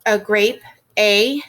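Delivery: isolates the long A from "grape" and says "A" alone; teacher sounding out the long A; vowel sound A. Shown as a demonstration. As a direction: pull the A sound out and stress it loudly. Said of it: "So this is an".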